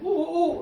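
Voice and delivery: high hooting ooh ooh ooh, mock bird; seagull cries. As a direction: play the flapping seagull's hoots. Seagull flying in, acted out.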